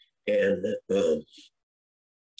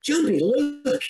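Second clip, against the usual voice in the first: Sharon calling him; 14 semitones higher, slower than usual. Retold or imitated. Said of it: "she proceeds to go".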